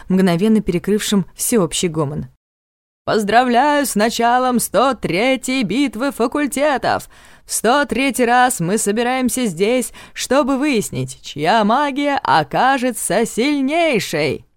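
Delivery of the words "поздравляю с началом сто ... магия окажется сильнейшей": high, slow ceremonial address; booming rector. Acted. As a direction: proclaim it slowly to the crowd. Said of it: "громыхнуло голосом".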